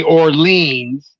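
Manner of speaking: "Orleans" as or-LEENS; long-ee pronunciation. Retold or imitated. Quoting how other people say it, "Orleans" as or-LEENS. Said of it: "I've seen lighter skinned people".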